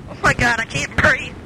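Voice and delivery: normal talking voice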